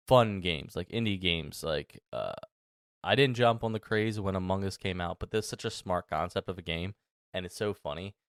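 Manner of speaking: ordinary talk, nothing marked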